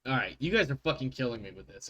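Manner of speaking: ordinary talk, nothing marked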